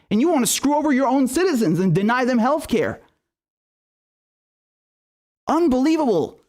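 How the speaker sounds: rising, indignant tirade; angry rant. Real, not acted.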